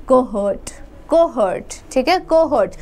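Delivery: कोहर्ट said as koh-hurt, the h sounded, stress on hurt; pronunciation demo. Shown as a demonstration. Teacher shows students how to say कोहर्ट as koh-hurt. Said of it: "इसको कैसे, अ, pronounce करना है".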